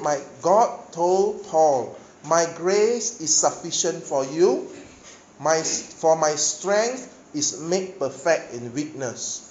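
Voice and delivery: slow, loud quoting of the verse; slow emphatic scripture. Acted. Preacher quotes God's promise to Paul, slow and emphatic. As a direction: lean on every word of the verse. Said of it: "a very powerful statement".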